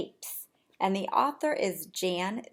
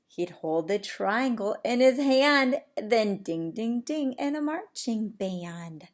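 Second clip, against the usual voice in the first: ordinary delivery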